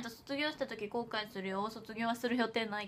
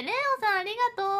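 bright greeting; 10 semitones higher, 7 dB louder, much faster than usual. Real, not acted. Greeting a new viewer.

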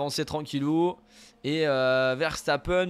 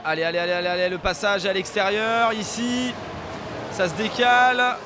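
motorsport commentator; 4 semitones higher than usual. Acted. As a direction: build the tension of a side-by-side battle. Calling an overtake live. (commentateur de course en direct, tendu)

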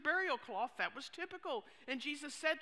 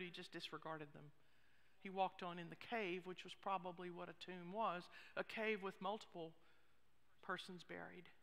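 ordinary talk, nothing marked

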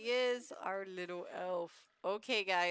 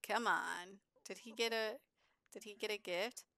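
normal talking voice

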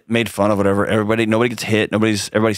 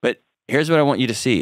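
ordinary delivery

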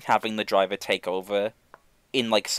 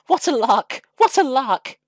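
high, loud and merry; gleeful chuckling exclamation; 13 semitones higher, 7 dB louder than usual. A narrator's reading. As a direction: crow it like a delighted engine. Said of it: "he chuckled to himself".

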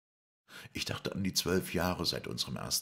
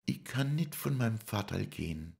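much higher voice; Lisei's voice; 9 semitones higher than usual. Acted. Lisei refuses to leave her father, much higher voice. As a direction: plead in a high voice. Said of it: "sagte sie dann".

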